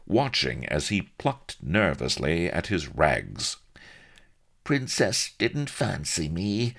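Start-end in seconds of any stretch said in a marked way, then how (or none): 4.6-6.8 high, slow rustic whine; shifty squeal. A narrator's reading. Solomon makes excuses to Blood, shifty squealing. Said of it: "he squealed shifty-eyed".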